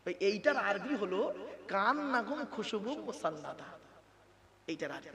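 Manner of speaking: normal talking voice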